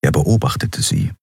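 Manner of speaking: ordinary delivery, nothing marked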